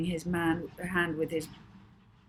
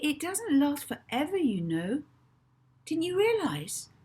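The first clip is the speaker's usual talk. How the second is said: a lightly voiced boy, higher and brighter; a touch of a boy explaining, pitched up; Rocky's voice; 10 semitones higher, 5 dB louder than usual. A narrator's reading. Rocky gently explaining to Molly, lightly voiced boy.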